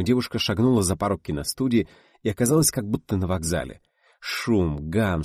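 ordinary delivery

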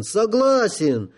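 repeated word shot up high and slow. A narrator's reading. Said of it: "обрадовался генерал Горбун". (радостно, высоко)

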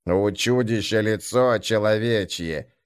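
plain reading voice

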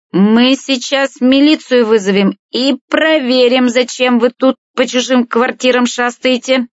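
high-pitched, drawn-out threatening woman; interrupting threat. A narrator's reading. Natalya cuts in threatening to call the police, high and indignant. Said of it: "перебила Наталья".

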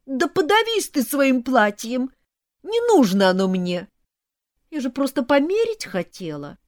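whine, then burst out in martyred outrage; wounded wailing complaint. A narrator's reading. Mother complaining to son about bride, aggrieved.

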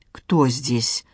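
low, hoarse, gurgling wounded man. Acted. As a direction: rasp it out through fluid in the throat. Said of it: "хриплым, булькающим голосом".

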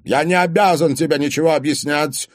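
loud, high, cutting reply; curt refusal. A narrator's reading. Old father refuses the boyfriend explanations, cutting him off. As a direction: shut him down. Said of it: "отрезал старик".

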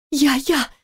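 высокий взволнованный женский выкрик; жена радостно отзывается очнувшемуся мужу; радостный возглас. Acted. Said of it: "воскликнула она". (высоко, радостно)

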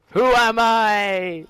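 high, loud, slow theatrical question; dramatic mock question. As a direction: wail it like an existential crisis.

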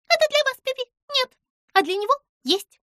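high, loud squeaky voice; mouse squeak. A narrator's reading. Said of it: "пискнула мышь". (пискляво)

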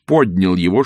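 ordinary delivery

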